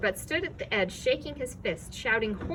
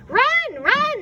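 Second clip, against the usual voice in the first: much higher, loud urging; urging shout; 9 dB louder than usual. A narrator's reading. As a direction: push them to flee. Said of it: "it urged once more".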